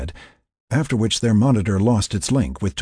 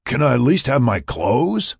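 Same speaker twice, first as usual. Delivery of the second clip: raised, higher-pitched call; yelled; 6 semitones higher, 3 dB louder than usual. A narrator's reading. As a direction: yell back plaintively, rising at the end. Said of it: "Mac yelled back".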